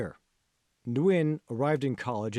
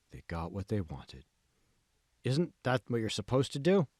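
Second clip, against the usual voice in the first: ordinary delivery, nothing marked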